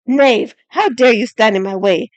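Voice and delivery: loud, slow snarl; snarling knight. A narrator's reading. Galloway threatens Severin with his sword, snarl. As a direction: snarl the challenge at him. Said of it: "Galloway snarled at Severin".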